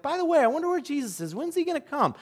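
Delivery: light, fast mock disciple voice; disciple's musing. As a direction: toss it off like a tired rower glancing back. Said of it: "and you're like".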